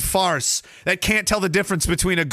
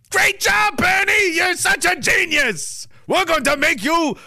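mock gushing praise; 7 semitones higher than usual. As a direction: gush over Bernie like a fake fan. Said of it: "It was probably more like".

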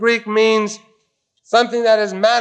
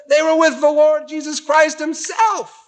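preaching shout; 8 semitones higher than usual. Real, not acted. Preacher's emphatic climax.

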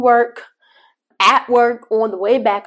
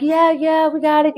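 higher, lightly voiced careless kids; kids brushing her off; 8 semitones higher than usual. Kids answering mom's morning questions, higher and dismissive. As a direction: toss it off like bored kids. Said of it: "And the kids are like".

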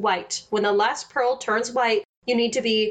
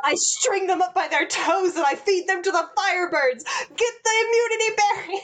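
higher, faster cold threat, then a sharp order; savage queen; 8 semitones higher than usual. Retold or imitated. Queen threatening her servant, savage and higher. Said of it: "she's savage".

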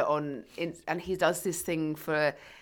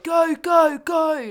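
loud, high mock trainer hype; hyped shouting; 9 semitones higher, 10 dB louder, much slower than usual. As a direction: yell it like an overexcited trainer. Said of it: "They're just like".

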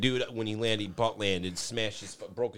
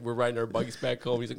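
ordinary talk

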